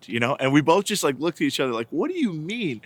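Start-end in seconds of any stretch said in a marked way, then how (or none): 1.9-2.8 higher, puzzled question; mock bafflement. Parents look at each other after son's outburst, mock bafflement. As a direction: act out baffled disbelief, pitch swooping up.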